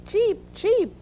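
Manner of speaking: very high, tiny duckling chirps; duckling cheeps. A narrator's reading. Ducklings chime in after Mother Duck, high cheeping. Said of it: "said the ducklings".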